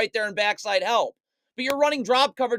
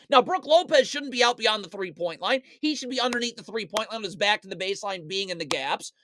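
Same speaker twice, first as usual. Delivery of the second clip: normal talking voice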